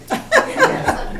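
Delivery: quick bright burst of laughter; a short real laugh; short high laugh. Real, not acted. Laughing after praise exchange.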